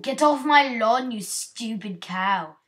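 loud hammed-up British accent. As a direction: bellow at a cow in mock British. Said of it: "I'm going to switch to my British accent".

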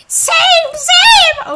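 very high, very loud scream; sudden piercing yell; screamed outburst. Pretend. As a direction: shriek it at full volume. Outburst echoing a chat request.

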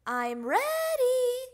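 high, loud, stretched-out shout; little frog hollering back; excited yell. A narrator's reading. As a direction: yell it back slowly and proudly. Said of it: "yelled Froggy".

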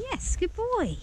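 high, slow sing-song praise for the horse. Real, not acted. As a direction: coo it out slowly and high, like praising a pet.

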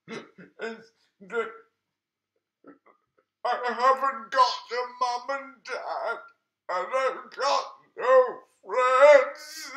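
a lonely monster pouring out hurt; sad monster. Acted.

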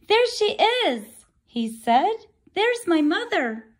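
high, eager cry; excited baby bird. Acted. Bird seeing the boat, high excited chick. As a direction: spot her and light up. Bird sees big thing.

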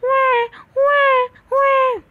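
put-on high, loud, slow wailing baby cry; imitating a baby's loud cry. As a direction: wail like a newborn. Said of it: "एक बच्चे की आवाज़".

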